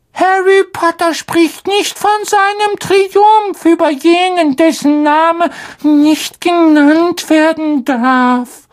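sehr hohe, langsame, ehrfürchtige Elfenstimme; Hauself Dobby. Acted. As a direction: wie ein unterwürfiger kleiner Elf, langsam und feierlich. Dobby voiced by narrator. (hoher, langsamer Elf mit Ehrfurcht)

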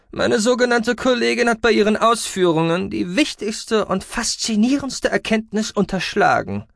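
high creaky voice, loud at the start; creaky, scornful scientist. A narrator's reading. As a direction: complain about a colleague as a self-important researcher.

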